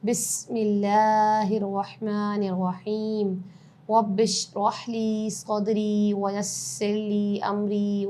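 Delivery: slow, drawn-out chanted recitation; Quranic recitation. Acted. Teacher opens the Quran lesson, slow chanted recitation. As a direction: intone each phrase slowly and reverently.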